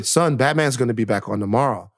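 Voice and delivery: low, gentle fatherly voice; imagine a kinder dad, voice dropped low; reassuring dad. Acted. Dad consoles son over Batman, reassuring. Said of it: "he should have let me cry".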